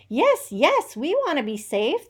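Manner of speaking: eager farm animals. A narrator's reading. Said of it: "they all said".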